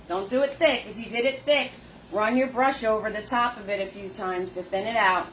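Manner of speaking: normal talking voice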